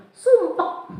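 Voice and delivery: put-on very high little-child voice; keluhan murid. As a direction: imitate a pupil's one-word complaint. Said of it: "Katanya sumpek Bu Gurunya". (suara anak mengeluh, nada tinggi sekali)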